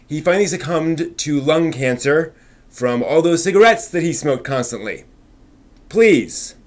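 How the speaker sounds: upbeat anime news host read. Acted. Host reading the weekly anime news to viewers, upbeat news read. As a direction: present the week's headlines like a show host.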